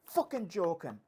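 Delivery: high, loud outraged Belfast rant with laughter breaking in; Northern Irish accent. Retold or imitated. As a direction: rant about the price like a furious Belfast man. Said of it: "And then Mickey".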